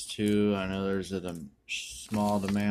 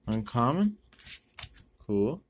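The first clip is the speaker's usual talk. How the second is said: usual speaking voice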